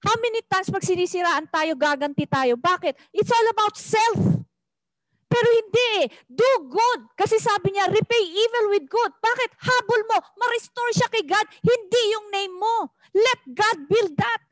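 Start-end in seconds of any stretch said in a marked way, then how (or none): 3.1-14.4 raised, urgent preaching voice. Real, not acted. Preacher urging the congregation to repay evil with good, raised and urgent. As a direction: press the point home to the congregation. Preaching rises to full force.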